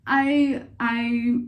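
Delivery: high, loud, halting mock refusal; retold stubborn refusal. Vlogger refusing to change their mind, playful stubborn refusal. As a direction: dig in, stalling on the word I. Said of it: "I'm like".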